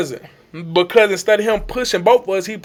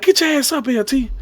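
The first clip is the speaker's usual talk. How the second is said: imitated coach; 7 semitones higher than usual. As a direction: mimic a coach urging the fallen player. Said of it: "that's how he was".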